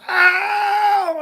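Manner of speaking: sharp high "Ow" cry of pain; pained yelp. Retold or imitated. John clawed by a lynx in the treetop, high yelp. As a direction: yelp like something just clawed you.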